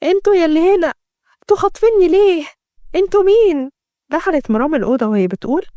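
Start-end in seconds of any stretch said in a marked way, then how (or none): 0.0-3.7 high-pitched, frightened calling out; fearful. A narrator's reading. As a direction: demand answers from unseen kidnappers, scared. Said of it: "كانت ليلى بتبص حواليها بخوف". (بخوف وتوتر)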